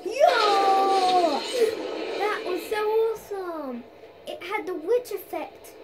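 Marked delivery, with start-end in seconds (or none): 0.1-3.8 very loud, high yell; excited shout. Real, not acted. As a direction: shout with thrill. Big moment in game.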